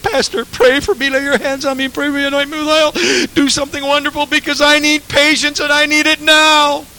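high, eager pleading woman's voice; pleading church lady. Retold or imitated. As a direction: beg the pastor like an impatient lady. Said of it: "the lady that came up and said".

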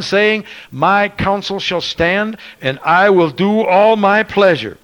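loud, ringing scripture reading, words hammered out; full-voiced revival preaching; preaching at full force. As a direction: thunder the verse to the congregation.

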